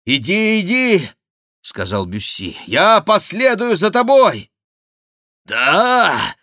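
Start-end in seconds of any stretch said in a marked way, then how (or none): 0.1-1.1 high, raised urging; urgent command. Acted. Бюсси торопит в бою. 2.7-4.5 high, loud promise; urgent call. Acted. Бюсси кричит вслед. 5.5-6.4 high, loud furious wail; howling. A narrator's reading. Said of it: "взвыл Монсоро".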